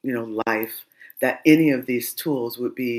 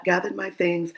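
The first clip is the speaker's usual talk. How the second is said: ordinary talk, nothing marked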